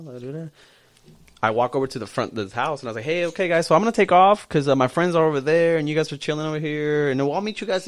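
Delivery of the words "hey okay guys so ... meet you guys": calling out. Retold or imitated. Announcing departure to the guys.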